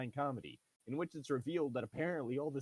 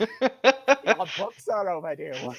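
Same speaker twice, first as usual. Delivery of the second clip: slow, high, loud hammed-up crone voice; creepy old librarian; 8 semitones higher, 16 dB louder, much slower than usual. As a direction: loom over them, dragging each word out. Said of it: "an old lady with very long arms and legs".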